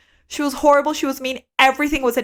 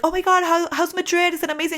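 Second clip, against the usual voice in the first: eager excitement; 7 semitones higher than usual. Retold or imitated. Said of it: "everybody would be like".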